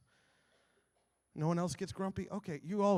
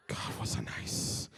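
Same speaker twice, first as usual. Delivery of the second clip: slow, whispered mock plea; anxious whisper; 5 dB quieter than usual. Pastor worriedly asking God, slow whisper.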